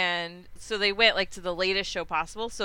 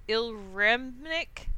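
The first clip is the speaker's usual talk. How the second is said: very slow, halting "Ilremnik Ekriep"; struggling to pronounce; slower than usual. Real, not acted. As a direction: sound out the strange name carefully. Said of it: "she can't pronounce it".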